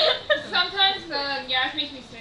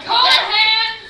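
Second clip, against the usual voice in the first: shouting; 7 semitones higher, 11 dB louder than usual. Real, not acted. Calling out.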